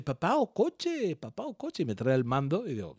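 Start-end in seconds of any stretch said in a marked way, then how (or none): 0.0-1.8 put-on high, slow baby voice asking to play; toddler asking. Son asking daddy for the car game, high slow baby voice. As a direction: ask like a small child wanting the car game. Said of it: "Y dice".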